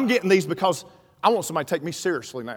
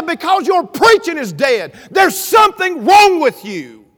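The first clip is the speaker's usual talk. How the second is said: loud, high, slow-hammered retold verdict; scolding outburst; 7 semitones higher, 7 dB louder, slower than usual. Wife tells preacher his preaching is dead, acted scolding outburst. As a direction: drive it home as the fed-up wife.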